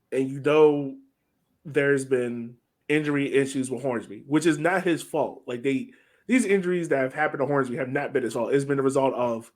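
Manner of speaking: ordinary talk, nothing marked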